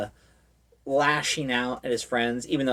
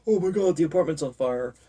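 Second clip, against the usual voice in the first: higher, gasping mock alarm; 5 semitones higher than usual. Friends finding the apartment ablaze, mock alarmed. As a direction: gasp and sound alarmed. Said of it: "his friend and his boyfriend are like".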